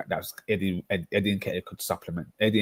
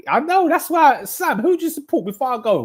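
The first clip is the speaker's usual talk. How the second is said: excited; 6 dB louder than usual. Real, not acted. Excited wind-up at Sam.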